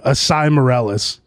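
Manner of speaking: ordinary delivery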